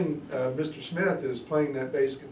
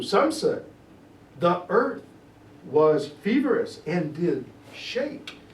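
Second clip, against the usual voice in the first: slow, louder and higher Shakespeare recital; dramatic reading; 4 semitones higher, 5 dB louder, slower than usual. Acted. Teacher performs Lennox's speech for the class with dramatic weight. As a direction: declaim it like a stage actor.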